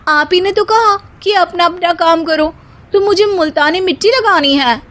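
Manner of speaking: high, loud, sassy reply; cheeky young bride. Acted. Daughter-in-law cheekily answers her mother-in-law, high and loud. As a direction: talk back to the mother-in-law.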